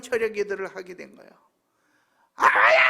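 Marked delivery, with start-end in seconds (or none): 2.3-2.8 loud, drawn-out prayer wail; reenacted crying out. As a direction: let out a full-throated cry to God. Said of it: "막 부르짖으면서 막 기도를 했어요". (부르짖는 기도를 흉내 낸 큰 외침)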